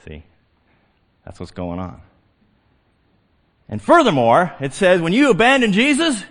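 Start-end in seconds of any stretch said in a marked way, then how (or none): none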